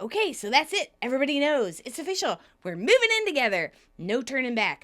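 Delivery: mildly hammed-up eager announcement; excited. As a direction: play at being thrilled. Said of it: "And Chandler is excited".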